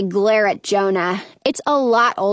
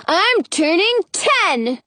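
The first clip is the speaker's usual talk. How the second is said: put-on little boy voice; 7 semitones higher, slower than usual. Jonah's high voice.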